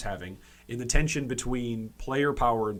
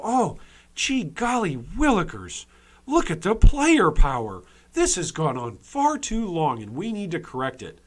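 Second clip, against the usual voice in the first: mock astonishment; 4 semitones higher, 5 dB louder than usual. Imitating game developers looking at the power graph, mock astonishment. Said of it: "they're looking at this and they go".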